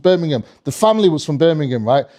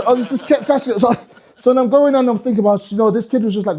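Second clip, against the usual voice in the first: normal talking voice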